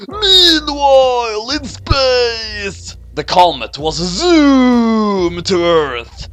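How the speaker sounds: slow, high, drawn-out trailer voice; milk the scene change; dramatic announcer. A narrator's reading. Narrator announcing the cut to space.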